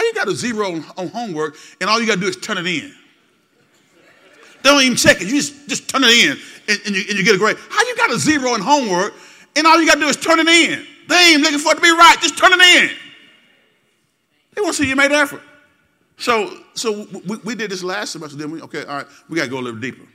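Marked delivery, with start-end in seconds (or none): 4.6-7.6 parent yelling. Acted. Preacher scolds as a parent. 7.7-10.9 much louder and higher scolding yell; parent yelling. Acted. Scolding question repeated, louder. 11.1-15.4 loud, higher scolding yell; parent yelling. Acted.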